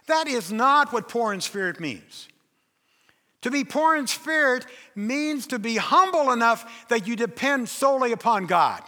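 ordinary talk, nothing marked

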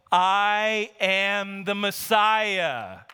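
very slow, drawn-out proclamation; momentous reveal. Acted. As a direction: reveal who you are, stretching every word. Jesus's solemn reveal, acted.

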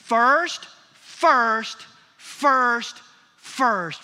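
"first" hammered loud, each one set apart by a pause; stress on first. Real, not acted. Preacher drumming the verse's key word into the congregation, "first" loud and emphatic. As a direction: punch out "first" loudly as a separate beat, over and over. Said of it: "I am emphasizing the word first".

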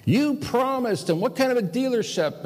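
high-pitched mock rant at the dealer; retold yelling. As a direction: squeal it out like an outraged customer. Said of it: "I started yelling at this guy".

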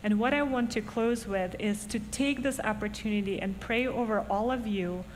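ordinary talk, nothing marked

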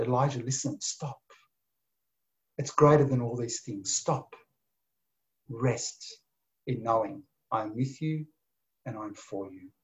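soft and low, with long gentle pauses; calm him down like a restless child; tender hush. Acted. God telling Elijah to stop and rest, softly.

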